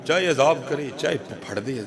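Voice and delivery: low, hushed, tearful admission; tearful master. Acted. Weeping master admits only God can forgive, low and soft. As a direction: say it through tears, quietly. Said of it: "مالک رو پڑا".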